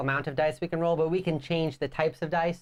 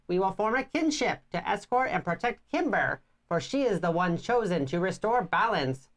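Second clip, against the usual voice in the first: lightly voiced wise old woman; a hint of a solemn village elder; old lady voice; 6 semitones higher than usual. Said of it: "I like your voice that you're using for the, like, old lady voice".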